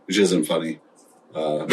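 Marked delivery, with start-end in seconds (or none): 1.3-1.7 short awkward chuckle; uneasy little laugh; nervous laugh. Real, not acted. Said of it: "It was a nervous laugh".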